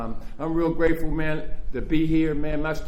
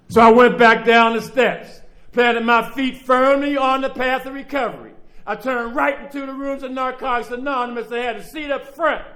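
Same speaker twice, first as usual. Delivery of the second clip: preaching at full force; 9 semitones higher than usual. Real, not acted. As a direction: preach it out to the whole room. Preaching his testimony at full force.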